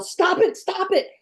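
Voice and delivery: high-pitched, urgent repeated cry; pleading yell. Acted. Woman yelling at neighbors through the ceiling, acted yell. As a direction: yell up at someone to stop, voice leaping high. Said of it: "and I yell".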